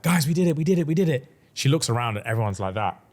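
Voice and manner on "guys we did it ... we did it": high, loud, elated victory call; excited shout. Retold or imitated. Heroine telling her friends they did it, high and elated. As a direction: burst out with joy to the group. Heroine celebrates the fixed cauldron.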